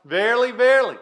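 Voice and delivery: "Verily, verily" lifted high, each word weighted; emphasised. Shown as a demonstration. Preacher pointing congregation to two words, emphasised "Verily, verily". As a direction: press firmly on both words of "Verily, verily". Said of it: "emphasize here a little bit".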